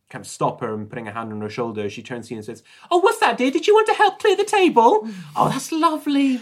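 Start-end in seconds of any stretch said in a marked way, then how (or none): 2.9-6.4 very high, louder little nurse voice; fussy kindly nurse. Acted. Nurse answering a player who stopped her clearing, high and sweetly helpful. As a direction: put on a high-pitched, chirpy helpful nurse.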